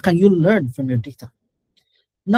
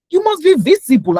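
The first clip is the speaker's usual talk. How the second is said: higher and louder, pressing hard; emphatic; 10 semitones higher, 6 dB louder than usual. Real, not acted. Coach urging entry-level staff to be visible, forcefully. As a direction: drive the point home.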